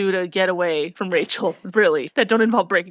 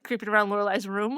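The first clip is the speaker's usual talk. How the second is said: ordinary delivery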